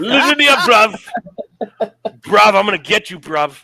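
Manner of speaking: loud, high, laughing cockney mimicry; mock British bruv. Cohost mocking the wrestler's bruv promo, loud laughing British accent. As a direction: shout it like a cartoon London tough.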